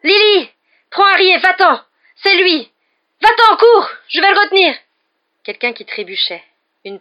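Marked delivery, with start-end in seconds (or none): 0.0-4.7 high, loud cries of panic; panicked shout. A narrator's reading. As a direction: yell an urgent warning to flee. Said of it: "pris de panique". (cri de panique)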